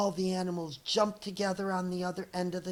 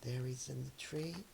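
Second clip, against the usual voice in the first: much softer, lower crowd murmur; hushed commentary; 4 semitones lower, 13 dB quieter than usual. Acted. Onlookers softly watching Dex in the tree. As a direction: narrate it under your breath.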